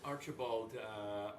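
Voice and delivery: ordinary talk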